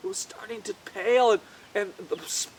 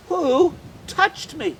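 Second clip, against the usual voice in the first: slow and searching; slow questioning; 4 semitones higher, much slower than usual. Acted. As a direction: ask it slowly, puzzled. Jesus stops and asks.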